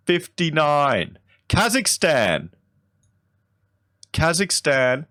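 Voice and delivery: high, slow, drawn-out disbelief; incredulous. Real, not acted. Vlogger finds Kazakhstan ranked above Australia, incredulous. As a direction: stretch out each word in astonishment. Shocked at Australia's low ranking.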